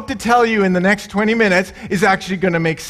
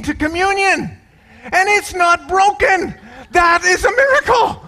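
excited rabbi; 8 semitones higher than usual. Retold or imitated. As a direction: show off the unbroken bottle like a miracle. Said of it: "He goes".

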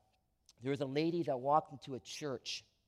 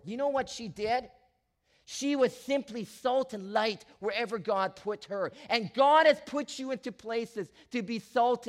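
high, loud building exhortation; preaching at full force; 8 semitones higher, 7 dB louder than usual. Acted. Pastor exhorting the church to be salt and light, loud preaching. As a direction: lift them toward kindness with fire.

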